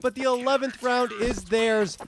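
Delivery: soaring high, slow and drawn out; excited clutch call. Real, not acted. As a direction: ride the climax of the clutch. Clutch round climax call.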